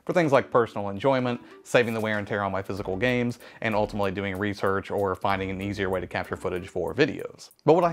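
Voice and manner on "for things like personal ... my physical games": ordinary talk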